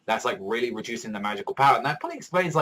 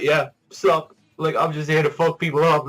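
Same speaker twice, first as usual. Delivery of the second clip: higher, louder, cocky and offhand; casual villain; 5 semitones higher, 6 dB louder than usual. Retold or imitated. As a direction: stroll in like it's nothing. Voicing Hendrickson arriving.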